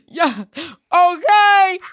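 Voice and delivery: awkward mock seduction bursting out loud; badly faked sexy. Shown as a demonstration. Friend demonstrating her sexy for co-hosts, hammed-up. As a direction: try to be sexy, ending in a loud "okay". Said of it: "this is me being sexy".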